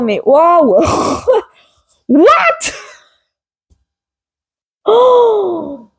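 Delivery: high, breaking cries of shock with a laugh; stunned disbelief. Real, not acted. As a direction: gasp at the card, unable to believe it. Shocked by the tarot card. (stupéfaction aiguë, entre rire et cri)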